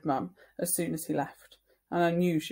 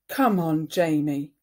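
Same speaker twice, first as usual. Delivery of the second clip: angry; 8 dB louder than usual. Acted. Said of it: "said Mum angrily".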